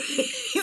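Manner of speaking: high, airy squeal of laughter; real giggle. Real, not acted. Amused by her own story.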